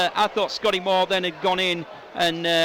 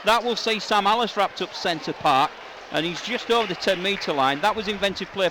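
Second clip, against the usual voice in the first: louder, higher, rising rugby commentary as Bradford press; live play-by-play; 5 semitones higher, 4 dB louder than usual. Acted. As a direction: call the attack near the line with building urgency. Live call of Bradford passing.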